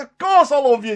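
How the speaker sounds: high, loud protest; re-enacted emphatic reassurance; exasperated insistence. Peter protests a third time.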